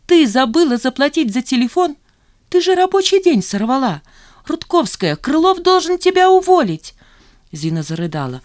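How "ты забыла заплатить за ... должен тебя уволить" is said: high, loud indignant outburst; outraged scolding. A narrator's reading. Zhanna berating Zina over the phone bill, outraged. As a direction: lay into a careless colleague, pitched high.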